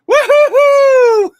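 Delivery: very high, loud, drawn-out whoop; triumphant whoop. Real, not acted. Host back on the livestream, triumphant whoop. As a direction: let out a long, piercing woo-hoo.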